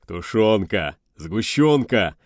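loud, drawn-out gleeful naming; overjoyed exclamations; delighted. Acted. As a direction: savour each treat as you announce it. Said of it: "расцвёл сержант".

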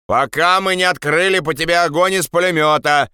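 threatening high-pitched call; shouting. Acted. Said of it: "крикнул другой". (угрожающий окрик)

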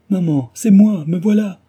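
very high, loud desperate cry. A narrator's reading. Girl runs to her mother's bed, shouting high. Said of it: "en criant".

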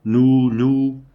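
loud ringing cry of nous. A narrator's reading. Man clinging to one word against death, loud cry. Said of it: "Il le répétait, il essayait".

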